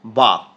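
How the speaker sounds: বক said as English bough, rhymes with cow; বক pronounced like bough; saying bough. Shown as a demonstration. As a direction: model word বক, spoken for pupils. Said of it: "এখানে উচ্চারণ করলাম হচ্ছে বক".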